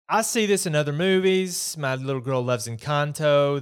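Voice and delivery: normal talking voice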